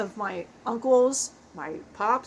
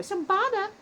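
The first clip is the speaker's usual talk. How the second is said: put-on "[name]" shouted higher like a [name] hook; [name] style; 7 semitones higher than usual. Shown as a demonstration. Joking how [name] would yell "[name]". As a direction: bark "[name]" like a rap hook. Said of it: "would have been a great Beastie Boy".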